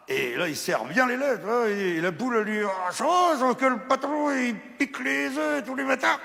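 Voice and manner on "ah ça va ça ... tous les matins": high hen voice. Acted. Hen answers.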